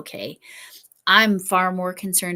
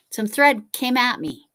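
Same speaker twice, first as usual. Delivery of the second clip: higher and louder, with stress on thread; emphatic retake; 5 semitones higher, 5 dB louder than usual. Shown as a demonstration.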